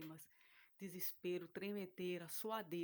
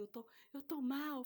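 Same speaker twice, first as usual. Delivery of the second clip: higher, slow, pitiful; feigned weakness; 7 semitones higher than usual. Retold or imitated. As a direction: play sick to make him pity you. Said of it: "falava com ele". (voz fraca e chorosa fingindo estar mal)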